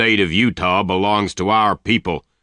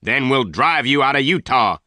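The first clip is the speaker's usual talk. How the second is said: restrained angry shout, pitched up; 6 semitones higher, 4 dB louder than usual. A narrator's reading. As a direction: drive the threat home in a raised voice. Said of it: "he shouted".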